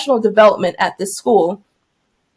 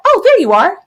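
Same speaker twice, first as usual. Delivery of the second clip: high-pitched imitation of casual discovery; mock cheery surprise; 8 semitones higher, 4 dB louder than usual. Lady finding the missing teacher, imitated light surprise. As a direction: imitate someone pleasantly finding you.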